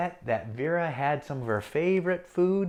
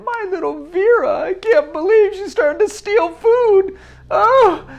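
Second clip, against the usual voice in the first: high, loud horrified gasp of a mother; 13 semitones higher, 9 dB louder than usual. Acted. Mother horrified to see her daughter stealing food, high and loud. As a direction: shriek it like a shocked mom.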